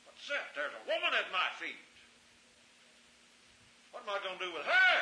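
high, loud and hurried; a startled man in the dark; startled Boaz. Acted. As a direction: Boaz jolted awake, blurting it out. Voicing Boaz startled at night.

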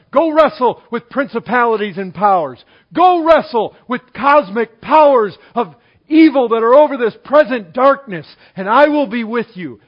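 loud, commanding sermon voice; rally the congregation like a charge; preacher thundering God's command to go; preaching at full force. Acted.

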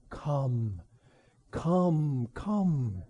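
softly, each call falling away; hushed, spaced-out invitation; gentle bidding. Acted. Preacher voices Christ bidding his people, gentle bidding.

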